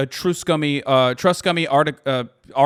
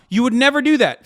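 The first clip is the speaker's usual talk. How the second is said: ordinary talk, nothing marked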